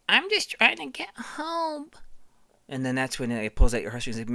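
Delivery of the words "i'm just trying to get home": high, slow little creature voice; tiny baby voice. Retold or imitated. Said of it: "And he's like".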